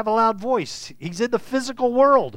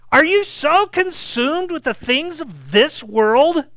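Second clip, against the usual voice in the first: exasperated rebuke; 4 semitones higher, 4 dB louder than usual. Pretend. Imagined exasperated teacher's rebuke.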